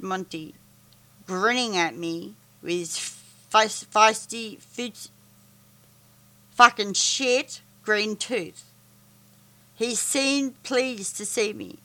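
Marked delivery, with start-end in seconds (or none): none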